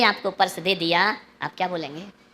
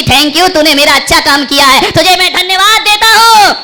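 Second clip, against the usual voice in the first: thanking the wife; 5 semitones higher, 8 dB louder than usual. Shown as a demonstration. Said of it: "उसे भी कहिए कि".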